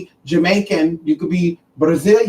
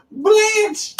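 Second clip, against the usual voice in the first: very high squeal between laughs; high-pitched, laughing outburst; cracking up; 13 semitones higher than usual. Real, not acted. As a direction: squeal the name out through giggles. Cracking up over the name Blanche.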